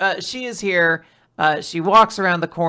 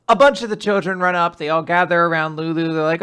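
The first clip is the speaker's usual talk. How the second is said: ordinary talk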